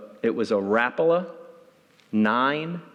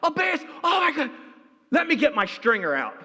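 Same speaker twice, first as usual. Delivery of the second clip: high, shouting with amazement; the grandfather sees the bass and shouts in amazement; excited grandfather; 14 semitones higher than usual. Retold or imitated. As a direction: voice the grandfather, stunned by the catch.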